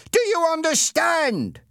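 high, loud and slow, each word hammered; shouted demand. Acted. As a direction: yell it down at the smaller boy. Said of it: "Ernie shouted".

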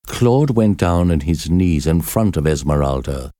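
ordinary delivery

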